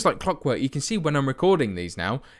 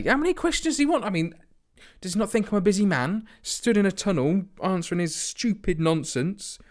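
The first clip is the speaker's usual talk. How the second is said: higher, faster annoyed rant; exasperated rant; 6 semitones higher than usual. Pretend. To viewers, fed up with the tunnel reporter, ranting higher and fast. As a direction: vent higher and quicker at the pestering reporter.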